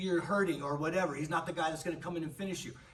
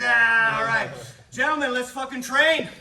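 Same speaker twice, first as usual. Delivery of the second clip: much higher and louder, pumping up the team; rallying shout; 8 semitones higher, 13 dB louder than usual. Real, not acted. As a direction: send them off to train with a big loud call. Coach rallies the gym.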